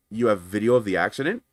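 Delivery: mildly incredulous checking question; retold puzzled question. Adjuster learning a video exists, puzzled. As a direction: echo it back, rising at the end.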